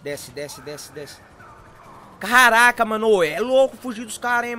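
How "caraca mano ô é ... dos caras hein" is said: loud thrilled yell mid-chase; excited shout. Real, not acted. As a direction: shout it out, buzzing with adrenaline. Escaping police in game. (grito empolgado)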